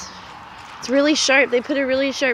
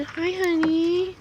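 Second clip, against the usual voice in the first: tender greeting; 5 semitones higher, 4 dB quieter, slower than usual. Real, not acted. Mother softly greeting a scared calf, tender coo. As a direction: coo it gently, drawn out.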